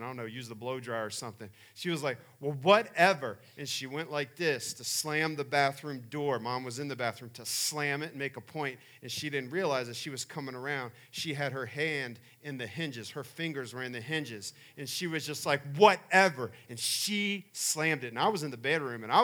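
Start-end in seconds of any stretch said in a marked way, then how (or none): 2.4-3.4 a louder, sassy whatever; sassy dismissal. Retold or imitated. Daughter sasses her mom. 15.8-16.5 higher, much louder, defiant whatever; defiant sass. Retold or imitated. Daughter slams door on mom.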